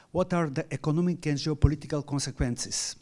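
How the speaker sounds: ordinary talk, nothing marked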